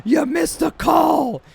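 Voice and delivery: high, loud heckle from the stands; heckling yell. Retold or imitated. Fan yelling a joke at the umpire, loud mocking heckle. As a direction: shout it across the ballpark at the umpire. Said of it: "I yelled out his name".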